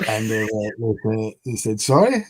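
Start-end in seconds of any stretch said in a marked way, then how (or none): 1.8-2.2 high puzzled "Sorry?"; a confused, rising "Sorry?"; puzzled question. Retold or imitated. Confused customers ask again.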